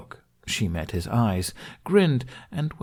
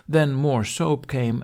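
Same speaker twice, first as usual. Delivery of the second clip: ordinary delivery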